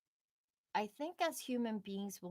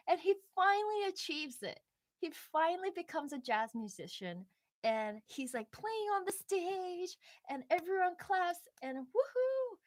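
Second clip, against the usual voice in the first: excited build; 9 semitones higher, 3 dB louder than usual. Acted. Retelling the triumphant moment.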